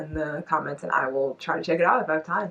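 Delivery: ordinary delivery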